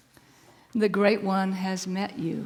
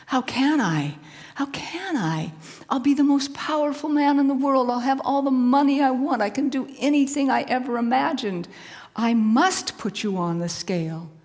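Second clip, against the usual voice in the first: louder and higher, pleading with himself; torn outburst; 5 semitones higher, 7 dB louder than usual. Acted. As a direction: blurt out his greed and doubt. Man torn between glory and mercy.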